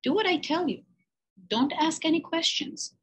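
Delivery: high, firm commanding teacher voice; stern teacher laying down the rules; strict old teacher. Acted. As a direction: bark orders like a strict old-fashioned teacher. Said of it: "a strict and old-fashioned teacher".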